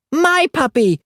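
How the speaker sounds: put-on high wailing toddler scream. A narrator's reading. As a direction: howl it like a toddler losing his toy. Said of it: "Aki screamed".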